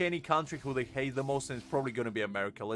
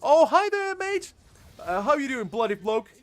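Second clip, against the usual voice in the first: hammed-up British bloke voice, much higher and louder; mock average Brit; 8 semitones higher, 4 dB louder than usual. A Brit mocking France, in a hammed-up British bloke voice. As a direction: play a cartoon British patriot scoffing at French food. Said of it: "do you really expect an average Brit to just rock up to France and be like".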